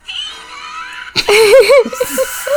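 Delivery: high shrieking burst of laughter. Real, not acted. Laughing at a mishap.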